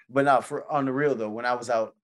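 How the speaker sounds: ordinary talk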